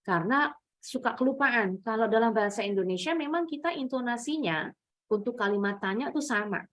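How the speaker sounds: ordinary talk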